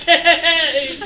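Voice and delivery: squeaky, laughing okay; comic high-pitched surrender; high-pitched giving in. Acted. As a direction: give in with a drawn-out high squeal. Child gives in to the pirate.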